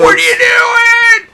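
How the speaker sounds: very high, slow shriek. Acted. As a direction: squeal it out, high and loud. Mock outburst at friend.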